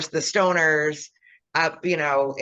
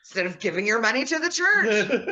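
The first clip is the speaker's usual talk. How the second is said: mock preacher; 6 semitones higher than usual. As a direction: scold like a greedy preacher. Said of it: "the priest".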